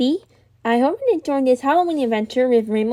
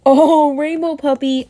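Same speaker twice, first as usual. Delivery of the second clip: ordinary talk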